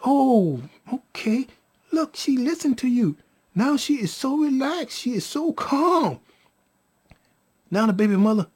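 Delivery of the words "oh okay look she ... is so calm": high, airy pleased surprise; relieved wonder. Retold or imitated. Baby mother notices the baby relaxed, relieved wonder. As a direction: imitate an amazed mother, pitched higher.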